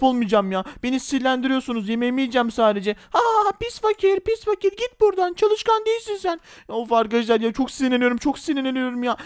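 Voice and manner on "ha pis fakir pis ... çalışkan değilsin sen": high, slow mocking sneer; taunting bully. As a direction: drag out the insult like a spoiled brat.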